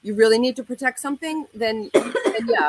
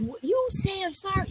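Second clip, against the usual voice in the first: higher, softer scolding demand; demanding an apology; 12 semitones higher, 4 dB quieter than usual. Retold or imitated. Young woman telling the guys to say sorry, higher scolding. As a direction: make them apologize. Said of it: "and I said".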